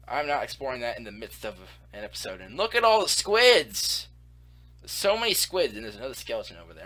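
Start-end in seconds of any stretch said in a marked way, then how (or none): 2.6-5.7 excited. Real, not acted. Sees many squids.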